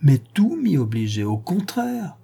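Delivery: mild eager outcry; a touch of youthful protest; exclaiming. Acted. Son protesting to his father, mildly exclaiming. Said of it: "s'écria Alexis".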